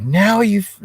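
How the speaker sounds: mock rage yell at the landlord; retold furious yell. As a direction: relive the blow-up, pitch flying up. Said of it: "yelling at the top of my lungs".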